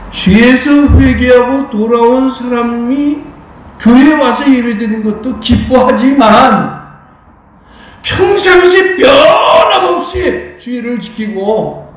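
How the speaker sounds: slow, swelling pulpit cry; full-force preaching. Real, not acted. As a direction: draw out the key words and build to a high shout.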